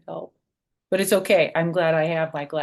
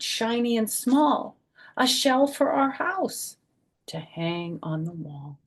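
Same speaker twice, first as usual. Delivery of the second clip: lilting read-aloud, a little higher; reading to children; 5 semitones higher, 4 dB louder than usual. A narrator's reading. Grandma reading picture book page to kids, lilting. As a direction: read it like a gentle rhyme.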